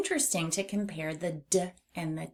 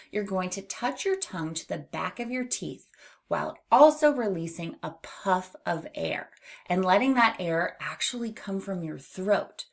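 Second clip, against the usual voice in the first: usual speaking voice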